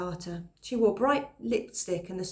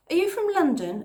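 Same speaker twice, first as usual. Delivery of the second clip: put-on bright, much higher girl's question; curious girl; 10 semitones higher, 6 dB louder than usual. Freckle-faced girl quizzing the newcomer, high and eager. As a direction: pitch her up like a young village girl. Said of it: "a freckle-faced girl asked me".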